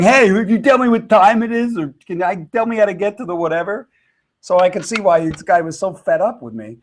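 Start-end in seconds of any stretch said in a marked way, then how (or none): 0.0-3.9 high, loud, fast pushy tourist; belligerent American. Retold or imitated. Mocking himself as the rude American tourist in France, loud and pushy. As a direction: barge in demanding like a rude tourist. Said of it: "belligerent American comes running in".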